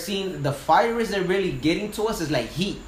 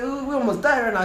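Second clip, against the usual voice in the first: much higher, fast and breathless retelling; retold panic; 10 semitones higher, faster than usual. Firefighter tells his captain they nearly died, panicked. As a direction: blurt it out like you just escaped death.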